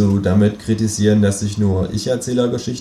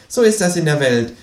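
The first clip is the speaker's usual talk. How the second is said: smug kangaroo, higher and self-satisfied; the kangaroo lecturing with a smirk, voice up; 5 semitones higher than usual. Acted. Kangaroo smugly explaining to the narrator who has money, higher voice.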